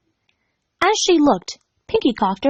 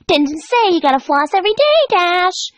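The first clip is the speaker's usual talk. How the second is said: high, perky joking pony; Pinkie Pie voice; 8 semitones higher, 3 dB louder than usual. Acted. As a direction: tease playfully. Pinkie's joke voice.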